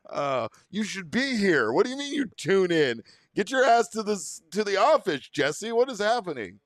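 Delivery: high, loud teasing call-out; playful scolding. Real, not acted. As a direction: demand he come in, loudly. Scolding Jesse to come in.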